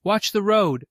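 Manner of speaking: high, loud frightened shout; screaming at the driver, high and loud; screamed warning. A narrator's reading. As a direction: yell at him to steer back. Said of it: "she screamed".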